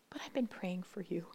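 soft unvoiced whisper of a secret kindness; hushed confidence. Preacher retells the nurse quietly whispering she's been praying. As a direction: breathe it like a forbidden confession.

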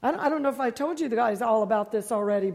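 ordinary talk, nothing marked

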